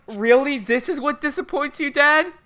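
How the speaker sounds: high, quick disbelieving protest; mock incredulous. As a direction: challenge Dad in put-on disbelief. Mock incredulous reply to Dad.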